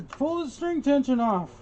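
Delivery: high-pitched mock backseat advice; mock heckler. Quoting imagined viewers who object to his method, high mock heckler. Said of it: "there's probably people out there".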